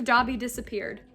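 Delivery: usual speaking voice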